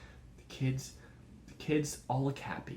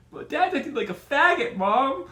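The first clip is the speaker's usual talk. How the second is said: put-on high, loud whiny kid voice; bratty kid; 12 semitones higher, 9 dB louder than usual. Son mouthing off to his father in the car, high loud whiny voice. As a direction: shriek it like a mouthy teenage son.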